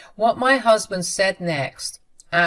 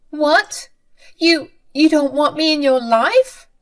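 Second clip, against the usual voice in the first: much higher, louder disbelief; stunned mother; 9 semitones higher, 7 dB louder than usual. A narrator's reading. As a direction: gasp out the shock of rejection.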